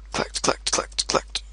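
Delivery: whispered hurried chant; urgent whisper. Real, not acted. Gamer whispering while grabbing iron in danger. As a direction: hiss it under your breath. Hurrying to grab items.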